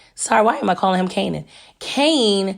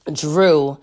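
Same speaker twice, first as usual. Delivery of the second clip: ordinary talk, nothing marked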